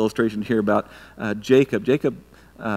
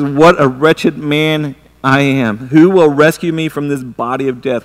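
anguished scripture cry; 8 dB louder, slower than usual. Acted. Reading Paul's lament aloud.

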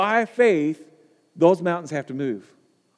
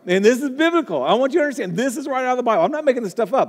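higher, earnest pleading that it's real; emphatic insistence; 6 semitones higher, much faster than usual. Acted. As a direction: convince a doubting crowd. Insisting the manure point is biblical.

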